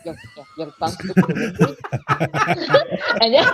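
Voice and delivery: Scots phrase broken up by laughter; laughing. Real, not acted. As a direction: say it while starting to laugh. Laughing at own Scots example.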